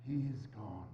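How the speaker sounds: grief. Acted. Scrooge mourns Tiny Tim.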